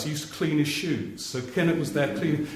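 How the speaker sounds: ordinary talk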